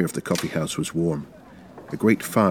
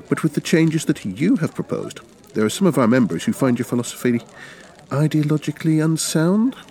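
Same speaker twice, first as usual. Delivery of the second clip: lightly raised, earnest revolutionary; a higher-pitched, persuasive comrade; Zederbaum's voice; 10 semitones higher, 3 dB louder than usual. A narrator's reading.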